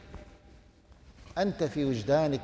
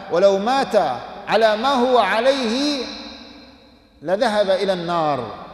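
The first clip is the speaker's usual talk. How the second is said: louder, rising urgency on the fate of hellfire; urgent preaching; 5 semitones higher, 6 dB louder than usual. Acted. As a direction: push it out with alarm. (بنبرة وعظ عالية ملحّة)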